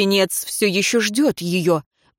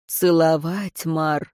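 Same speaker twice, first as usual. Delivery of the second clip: usual speaking voice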